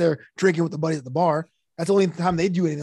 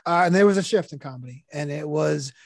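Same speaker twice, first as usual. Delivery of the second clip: ordinary delivery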